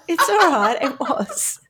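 laughing through the words; amused laugh while retelling. Retold or imitated. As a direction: giggle it out as you speak. Laughing while quoting herself.

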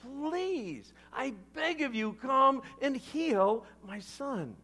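high-pitched, desperate begging; pleading. Acted. Father begs Jesus to heal his son, pleading. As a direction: plead on a high voice, stretching the last words. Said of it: "The official pleaded".

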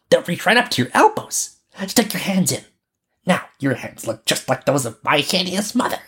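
put-on high child's voice, amused; bossy robber girl. Robber girl dresses Gerda for the cold, amused. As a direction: fuss over her in a high little voice.

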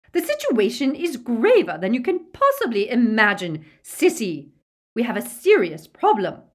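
loud, high, frantic tirade; screaming. A narrator's reading. Bellatrix warns her sister of grave trouble, screaming. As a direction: keep shouting urgently at full pitch. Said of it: "but Bellatrix screamed".

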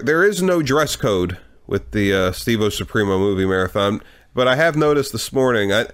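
normal talking voice

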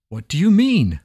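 sharp, rising alarm; alarmed demand. Acted. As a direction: demand it in a sudden panic. Wazir alarmed, answers not found.

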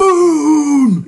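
drawn-out, high, loud shout; Piccolo. Retold or imitated. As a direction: imitate an anime character bellowing one long word. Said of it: "Piccolo goes".

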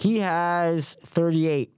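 slow, drawn-out guess. Real, not acted. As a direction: stretch the number out. Guessing the credit count.

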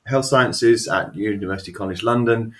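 loud, bright show-host welcome; announcer welcome. Acted. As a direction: boom it out like a host. Big show welcome to guest.

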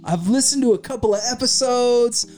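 eager cop fan. Retold or imitated. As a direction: gush like a starstruck cop. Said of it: "He goes".

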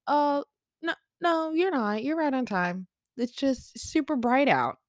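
high sing-song reassurance; reassuring. Retold or imitated.

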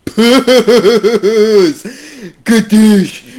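loud, high shouted catchphrase; shouted exclamation. Acted. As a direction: yell it into the mic. Shouted sound effect.

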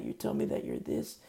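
ordinary delivery

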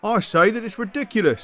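loud, high indignant protest; indignant outburst. Acted. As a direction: burst out against the charge. Accused protests in court.

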